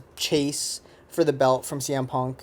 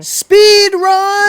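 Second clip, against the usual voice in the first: shouted high and loud, drawn out; announcer shout; 10 dB louder than usual. Real, not acted. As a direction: belt it out like a game-show announcer. Host announces speed round.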